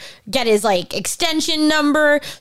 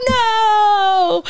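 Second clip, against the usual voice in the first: high wailing no; hammed-up despairing no; mock wail; 8 semitones higher than usual. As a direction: wail it like a crushed kid. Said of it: "And I was like".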